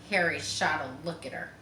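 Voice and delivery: plain reading voice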